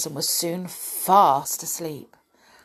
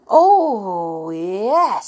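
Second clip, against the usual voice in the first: drawn-out, louder chorus of approval; eager agreement; 3 semitones higher, 7 dB louder than usual. A narrator's reading. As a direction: relish the plan as a group. All the toys agree eagerly.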